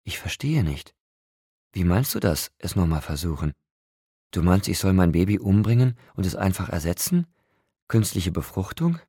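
baffled questions rising in pitch; fassungsloses Nachfragen. A narrator's reading. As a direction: ask in mounting disbelief. Bella fragt fassungslos nach. (fassungslos nachfragend)

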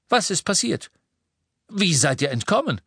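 high, urgent interrogation; sharp. A narrator's reading. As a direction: press sharply for answers. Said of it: "sagte Lupin scharf".

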